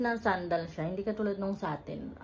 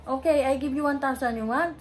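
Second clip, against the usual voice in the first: louder, raised haggling voice; pushy vendor haggling; 6 semitones higher, 8 dB louder than usual. Retold or imitated. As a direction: press the buyer hard. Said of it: "sabi niya". (nagpupumilit na tindero, malakas ang boses)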